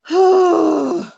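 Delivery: loud, drawn-out sigh close to a sob; sighing over a dead guinea pig; heavy sigh. Real, not acted. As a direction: let out a long, heavy sigh. Grieving for the lost pet.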